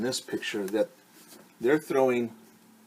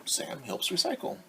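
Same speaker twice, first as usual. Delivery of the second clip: whisper; 6 semitones higher, 8 dB quieter than usual. Acted. Title whispered at close.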